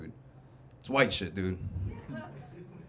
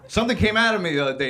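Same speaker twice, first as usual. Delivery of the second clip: ordinary delivery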